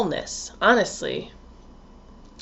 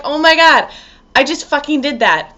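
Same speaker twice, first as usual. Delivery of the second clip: louder, higher-pitched burst of embarrassed disbelief; mock shocked realization; 4 semitones higher, 5 dB louder than usual. Imagined Cancer realizing they cried in public, mock shocked. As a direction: play someone catching themselves mid-blunder. Cancers' reaction to themselves, acted.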